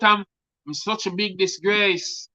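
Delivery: a touch of a scream in the telling; mild screaming. Acted. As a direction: build the scream then drop off. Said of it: "screaming out".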